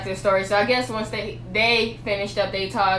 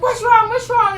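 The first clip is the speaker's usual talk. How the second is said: worried mom; 13 semitones higher, 7 dB louder than usual. Retold or imitated.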